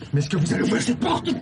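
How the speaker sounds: exasperated, voice rising; losing patience. Acted. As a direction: demand it, temper giving way. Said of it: "je m'énerve". (exaspération qui monte)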